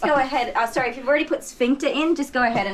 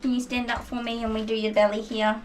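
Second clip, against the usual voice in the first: ordinary talk, nothing marked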